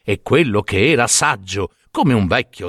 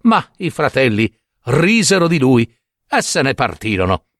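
plain reading voice

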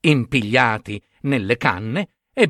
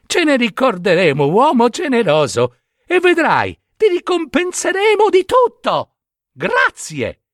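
climbing much higher as they promise; young ravens thanking the man in high voices; grateful young ravens; 11 semitones higher than usual. Acted.

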